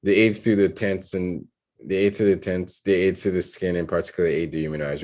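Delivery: fast, run-on skim through a draft; rapid read-back. Real, not acted. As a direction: rattle it off without pauses. Going back over the written draft.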